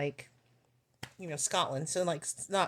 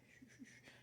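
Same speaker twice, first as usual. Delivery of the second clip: very soft, voiceless shushing; quick hushed "shh" to cut someone off; hushing shush; 28 dB quieter than usual. Retold or imitated. Man shushing the front desk lady, hushed.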